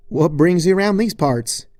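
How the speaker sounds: loud, folksy Southern drawl greeting; a cheery country-girl howdy in a Southern drawl; Applejack's Southern drawl. Acted. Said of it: "in her usual awkward drawl".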